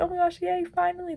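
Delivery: high, soft imitation of excitement; mock hyped. Imitating the girl who thinks she could escape, hyped. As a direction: put on a small, high-pitched yay of relief. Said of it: "she was all hyped".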